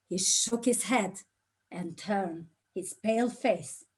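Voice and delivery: ordinary talk, nothing marked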